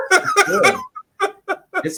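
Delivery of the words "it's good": laughing. Real, not acted. Laughing at beating the record.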